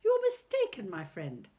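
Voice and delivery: a proud horse, slow and high at times; lightly voiced noble horse talking down to the duck; mild snooty horse voice; haughty horse. A narrator's reading. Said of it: "the horse snorted".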